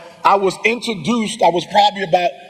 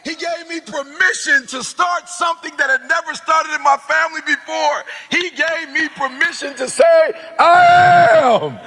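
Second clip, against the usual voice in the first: full-force preaching; 7 semitones higher than usual. Acted.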